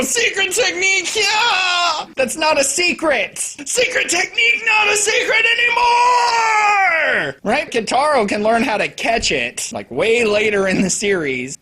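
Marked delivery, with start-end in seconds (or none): 0.0-2.1 mock battle cry. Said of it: "you go". 3.4-7.4 mock battle cry. Mocking the shouted attack name.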